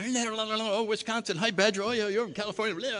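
high, quick delighted greetings; excited small talk. Retold or imitated. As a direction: bounce from guest to guest, thrilled. Chatting with everybody, elated.

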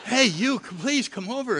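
high, loud eager call; excited calling. Retold or imitated. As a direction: call him over eagerly. Friendly guys calling him over.

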